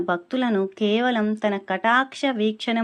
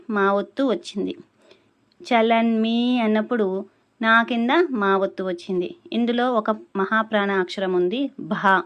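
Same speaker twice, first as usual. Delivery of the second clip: ordinary talk, nothing marked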